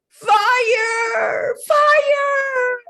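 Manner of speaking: high, loud frightened scream; children shrieking fire; terrified cry. A narrator's reading. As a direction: scream it in panic.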